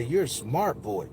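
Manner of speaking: slow, low, soft woman's voice; smooth praise. Retold or imitated. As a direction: imitate a soothing woman praising a child. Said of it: "it was just the smoothest".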